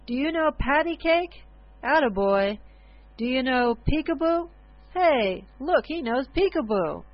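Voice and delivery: loud calls across a room; shouting. A narrator's reading. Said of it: "the old bum was shouting from across the room".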